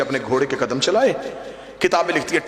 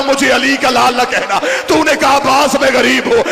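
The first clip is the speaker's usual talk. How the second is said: climbing louder and higher with every plea; preaching building to full force; 6 semitones higher, 5 dB louder than usual. Acted. [name] pleading Abbas's case to the gathering, building to a cry. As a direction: lift the refrain step by step into a cry.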